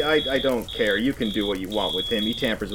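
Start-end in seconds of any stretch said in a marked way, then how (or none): none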